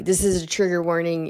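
ordinary talk

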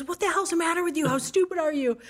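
high, fast mock yelling; retold screaming boss. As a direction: rattle it off like an irate booth guy. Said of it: "he was just screaming at me".

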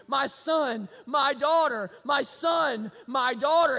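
put-on loud, tender call to lost children. Jesus calling his lost children home, loud and tender. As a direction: call out so they hear you far off. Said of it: "the Lord Jesus calling out to you".

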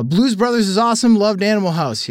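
high, loud shouted read of a text; reading as a yell. Retold or imitated. Host reads an all-caps listener text as a shout. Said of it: "stop yelling at me".

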